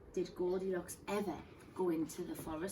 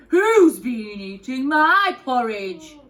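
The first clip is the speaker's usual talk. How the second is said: loud, slow gruff bear; Daddy Bear; 3 semitones higher, 13 dB louder, slower than usual. Acted. Daddy Bear finding his porridge eaten, loud slow growl. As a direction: boom it out like a big father bear. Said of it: "said Daddy Bear".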